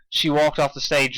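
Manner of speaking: ordinary delivery, nothing marked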